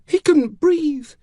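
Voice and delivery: put-on high, slow little girl's voice; very high, loud and drawn out; distraught child. A narrator's reading. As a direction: force it out as a stricken child. Child describes father's death.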